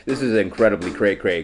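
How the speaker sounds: usual speaking voice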